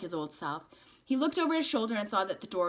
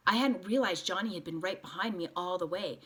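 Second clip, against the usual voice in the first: ordinary delivery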